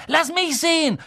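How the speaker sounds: high-pitched bratty insistence; demanding. A narrator's reading. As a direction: demand a look at once. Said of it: "verlangte Dudley".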